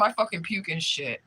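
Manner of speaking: normal talking voice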